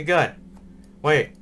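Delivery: normal talking voice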